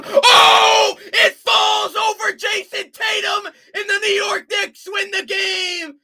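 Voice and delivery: screaming the call, high and loud; anguished shout. Real, not acted. Caller watching the Knicks win at the buzzer, anguished shout. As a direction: cry out as the winner falls.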